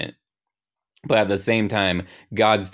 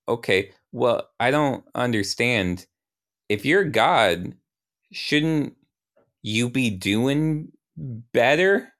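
higher, slow, halting challenge; doubtful questioning; 5 semitones higher, slower than usual. Acted. Young Ryan questions God's competence, slow rising doubt. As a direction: spell the doubt out slowly, climbing at the end.

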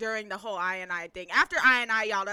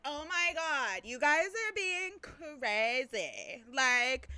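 mock scandalized girls; 4 semitones higher than usual. Imitating two girls appalled at the crowd, mock scandalized. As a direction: stretch the words in exaggerated disbelief.

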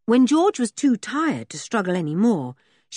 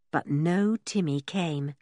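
ordinary delivery, nothing marked

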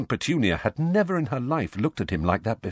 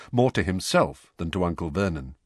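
ordinary delivery, nothing marked

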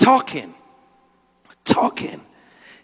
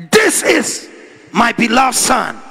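high, loud proclamation from heaven; booming pulpit rendering of God; 6 semitones higher, 4 dB louder than usual. Acted. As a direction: declare it as God's commanding voice. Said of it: "And a voice came".

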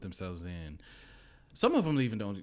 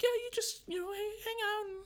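very high put-on girl voice; light high-pitched girlish impression; girl's voice. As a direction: imitate a decoy girl, casual and high. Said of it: "the girl was like".

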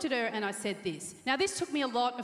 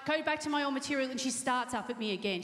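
ordinary delivery

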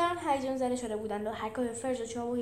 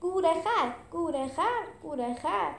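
raised, rhythmic crowd chant, easing off; mock crowd chant, higher and louder at first; crowd calling out; 6 semitones higher, 3 dB louder, slower than usual. A narrator's reading. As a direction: call the word out like cheering spectators.

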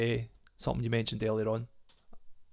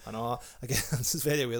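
ordinary talk, nothing marked

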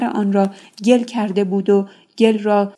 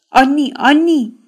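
urgent call; 5 semitones higher, 5 dB louder than usual. Acted. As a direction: call his name loud and high, pressing. Yamaq bursts in calling. (صدا زدن بلند و فوری)

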